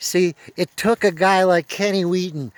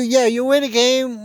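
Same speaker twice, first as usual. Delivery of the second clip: usual speaking voice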